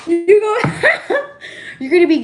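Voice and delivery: high squealing laugh through the words; bursting laughter. Real, not acted. Reading tarot for a viewer, bursting into real high laughter. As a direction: crack up mid-sentence. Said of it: "I'm screaming".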